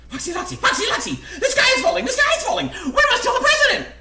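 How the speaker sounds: very high, loud bird shrieks; panicked yell. A narrator's reading. Frantic birds alerting their friend Goosey Lucy, high shrieking yell. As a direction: squawk the alarm at full pitch. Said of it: "yelled Chicken Licken".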